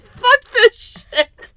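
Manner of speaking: high, loud, drawn-out retold wail; exasperated outburst. Recounting pausing the dating account, loud exasperated wail. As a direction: wail it slowly, fed up.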